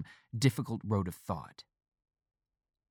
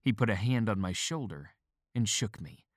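usual speaking voice

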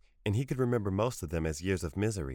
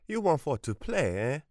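high, mocking grandmother with stress on want; mocking echo; 8 semitones higher, 4 dB louder than usual. A narrator's reading. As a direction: throw his words back at him scornfully. Grandmother mocks his plea.

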